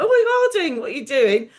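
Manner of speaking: high, excited mock [name] greeting; lightly done [name] impression. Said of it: "He's like".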